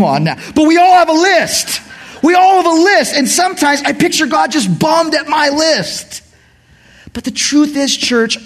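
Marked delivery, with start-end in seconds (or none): 0.6-3.1 high, loud declaration; full-voiced preacher's cry; preaching at full force. Real, not acted. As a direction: drive it home to the church.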